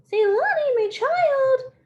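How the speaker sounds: hammed-up fake womanly voice; leopard faking woman. Leopard pretending to be the mother, hammed-up falsetto. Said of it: "make his voice sound like a woman's voice".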